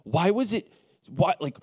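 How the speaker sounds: a touch of mock despair, asking the grass why; half-mocking exasperation at the dying lawn; exasperated self-talk. Retold frustration over dying grass.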